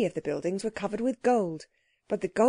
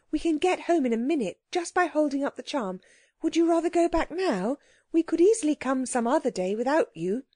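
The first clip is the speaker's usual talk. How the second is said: put-on high, girlish reassurance; reassuring girl; 7 semitones higher than usual. A narrator's reading. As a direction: soothe a frightened companion in a high voice. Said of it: "said Anthea".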